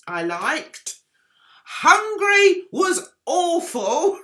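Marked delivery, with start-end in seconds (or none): 1.6-4.2 laughing. Real, not acted. Laughing at the bad entry.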